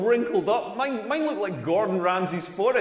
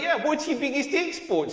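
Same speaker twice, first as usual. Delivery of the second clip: higher-pitched mock Australian; Australian accent; 6 semitones higher than usual. Comedian as his Australian flatmate repeating his question, Australian accent. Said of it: "that's exactly what Australians sound like".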